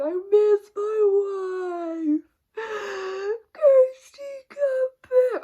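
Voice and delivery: over-the-top mocking blubbering plea; mock bawling. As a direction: imitate him sobbing his heart out. Husband balled up crying on couch.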